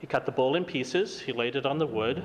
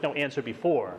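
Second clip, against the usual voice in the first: ordinary delivery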